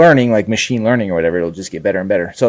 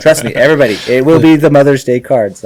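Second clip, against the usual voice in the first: loud, high mock proclamation; insane-sounding insistence; 5 semitones higher, 8 dB louder than usual. Guest insisting to disbelieving relatives about the cards, loud and emphatic. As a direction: raise the voice like a crackpot prophet. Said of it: "my family thinks I'm insane when I'm like".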